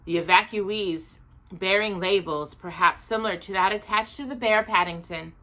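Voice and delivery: usual speaking voice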